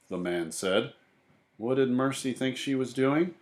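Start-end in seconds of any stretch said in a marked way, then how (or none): none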